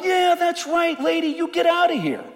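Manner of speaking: high, loud mocking dismissal; mocking disciples. Disciples tell the Gentile woman to leave, high mocking sneer. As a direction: shoo her away in a high sneering voice. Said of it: "they're going".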